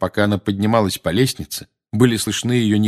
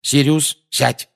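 firm command; 7 semitones higher, 3 dB louder, slower than usual. Acted. Lupin orders.

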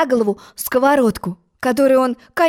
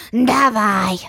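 sighing. A narrator's reading. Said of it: "со вздохом согласился Тик".

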